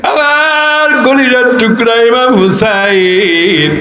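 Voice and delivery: imitated weeping lament, drawn out in a chant; কান্নার অভিনয়ে বিলাপ. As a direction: wail the names slowly, as a grieving grandfather. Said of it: "চোখের পানি ছেড়ে কান্দে". (কাঁদো কাঁদো সুরে টেনে টেনে বিলাপ)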